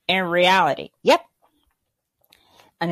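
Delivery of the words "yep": ordinary talk, nothing marked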